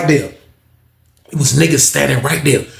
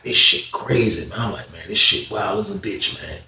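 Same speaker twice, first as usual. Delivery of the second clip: awed murmur; 6 semitones lower, 5 dB quieter than usual. Retold or imitated. Narrator amazed after arrest.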